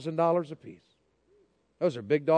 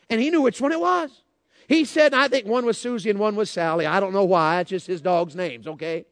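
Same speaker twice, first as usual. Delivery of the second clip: ordinary talk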